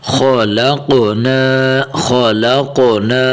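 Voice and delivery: slow خلقنا with thick kh and back-of-throat q; heavy kho and qaf. Shown as a demonstration. Teacher models it for pupils, خلقنا with heavy kh and qaf. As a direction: thicken kh and q in خلقنا, chanting slowly. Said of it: "خو کو بھی موٹا کر کے پڑھنا ہے".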